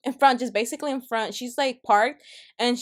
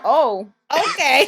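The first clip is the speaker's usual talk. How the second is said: laughing retold reaction; 3 semitones higher, 8 dB louder, slower than usual. Laughing at rude customer's outburst.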